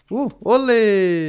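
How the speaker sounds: drawn-out, high, loud olé; loud, stretched-out whoop; playful cheer. Real, not acted. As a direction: call it out like a bullfight crowd. Dodging enemy in game.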